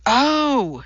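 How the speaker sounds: awed, drawn-out oh; breathed recognition. A narrator's reading. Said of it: "she breathed".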